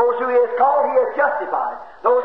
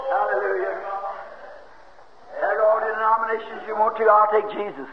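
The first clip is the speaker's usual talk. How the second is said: usual speaking voice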